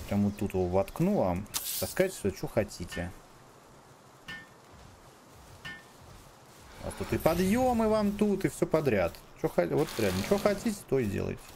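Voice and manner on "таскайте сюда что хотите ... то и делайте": high, sing-song offering to the pals; mock generous. Real, not acted. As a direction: talk to them like pets. Addressing game creatures.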